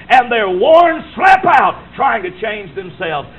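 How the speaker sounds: each word pounded out slowly; slow emphatic shout. Real, not acted. Preacher to congregation: worn-out sinners, slow pounding shout. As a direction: hammer every word home.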